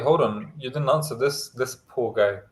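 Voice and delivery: ordinary talk, nothing marked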